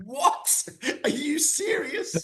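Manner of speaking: high, slow "What? Are you serious?" in mock disbelief; stunned disbelief. Teammates reacting to him quitting drink, mock disbelief. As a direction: imitate a teammate who can't believe it. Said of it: "it was like".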